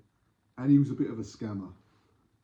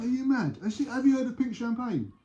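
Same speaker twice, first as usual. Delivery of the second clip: high-pitched mock scoffing; acted incredulity; 12 semitones higher, 3 dB louder than usual. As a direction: play the scammer brazening it out. Acted scammer scoffs at buyer.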